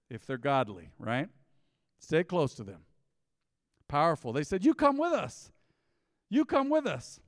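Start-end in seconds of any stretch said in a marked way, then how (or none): 4.6-7.2 high, loud, insistent invitation; acted urging. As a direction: call them along, pitched up and emphatic. Quotes men insisting he join them.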